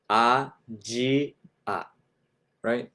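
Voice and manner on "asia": Asia drawn out as Ajia; Asia as ah-jee-ah, slower; アジア、ゆっくり; slow Japanese Asia. Shown as a demonstration. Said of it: "that will be".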